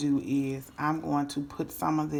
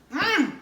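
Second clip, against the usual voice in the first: high, loud mmm of delight; savouring hum; 13 semitones higher, 8 dB louder than usual. Real, not acted. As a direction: hum loudly over a delicious bite. Savouring a bite of food.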